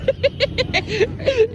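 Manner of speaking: high-pitched laughing burst; giggly high laugh. Real, not acted. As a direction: squeal with laughter. Laughing at the roti joke.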